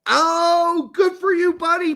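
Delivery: loud, high-pitched cheer; hearty congratulation. Real, not acted. Host cheering a player's new baby, hearty congratulation. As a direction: whoop it out to a buddy.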